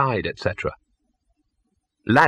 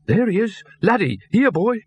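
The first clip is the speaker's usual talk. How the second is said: much higher calling out, relieved; 11 semitones higher than usual. A narrator's reading. Trainer spots Laddie in the alley, calling out in relief. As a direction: shout for the lost dog, glad to see him. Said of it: "The words dripped relief".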